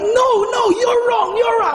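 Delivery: very high-pitched, insistent shouting; enraged protest. Retold or imitated. Customer cutting off the guard's excuse, enraged. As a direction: act out refusing to listen, enraged. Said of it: "I'm just really enraged".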